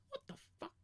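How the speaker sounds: high, quick imagined reaction; mock disbelief. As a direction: play stunned disbelief, high and fast. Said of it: "his face go".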